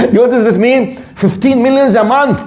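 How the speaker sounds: laugh, then marvel at the sum; amused disbelief. Real, not acted. Telling listeners the monthly zakah figure, laughing in disbelief.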